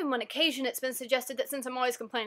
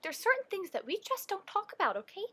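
lightly voiced mom, higher and lilting; mom explaining; 8 semitones higher, 4 dB quieter than usual. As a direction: gently lay down a rule like a mother. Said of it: "this conversation with my mom".

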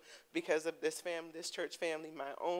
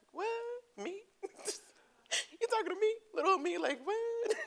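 high-pitched, squeaky "who, me?"; stunned disbelief; 3 dB louder than usual. Retold or imitated. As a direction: gasp out a delighted can't-believe-it. Disbelief at friend's car offer.

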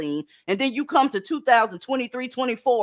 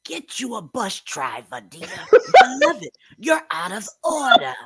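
Iyanla; 3 dB louder than usual. Acted. Friends ask for the voice, hammed-up scolding impression. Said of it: "you gotta do the Iyanla voice".

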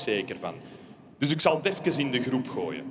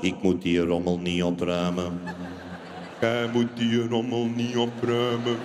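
Jedi mind trick; 6 semitones lower than usual. Acted. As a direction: lull him with a mind trick.

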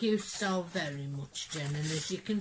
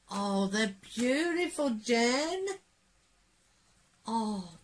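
ordinary delivery

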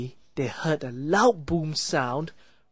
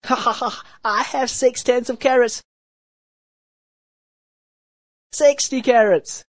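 high cheerful voice with a laugh; cheerful sixth brother showing off; a gleeful brother laughing over his carrots; 8 semitones higher, 4 dB louder than usual. A narrator's reading. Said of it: "the sixth brother cheerfully said".

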